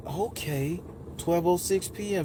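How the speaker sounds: soft, approving imitation; gently confirm, like a soothing guide; smooth woman's voice. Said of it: "it was just the smoothest".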